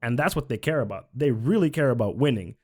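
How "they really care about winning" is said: ordinary delivery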